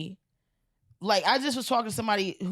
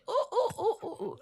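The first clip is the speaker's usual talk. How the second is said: monkey hoots; 13 semitones higher than usual. Acted. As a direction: answer with high ape calls. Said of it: "And the monkey said".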